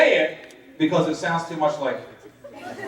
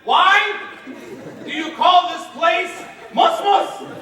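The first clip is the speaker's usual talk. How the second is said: angry shout; 9 semitones higher, slower than usual. Retold or imitated. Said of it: "and I said".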